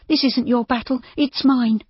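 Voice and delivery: high-pitched, worried insistence; anxious. A narrator's reading. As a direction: insist anxiously, voice lifted well up. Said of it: "Judy said anxiously".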